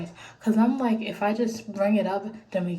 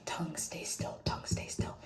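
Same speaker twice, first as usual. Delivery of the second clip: whispering; 4 semitones lower, 8 dB quieter than usual. Retold or imitated. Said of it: "The whole time I was like".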